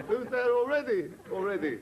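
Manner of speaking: high, loud hail from afar; stranded skier calling. Acted. Stranded skier calling to the rescuers, loud high shout. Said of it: "he was crying for help".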